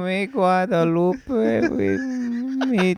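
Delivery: slow, high mock Spanish; hammed-up Mexican accent.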